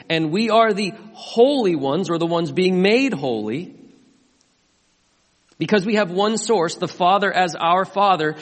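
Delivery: loud, high, emphatic sermon voice; rising sermon fervor; full-force preaching. Acted. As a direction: preach it out hard to the room.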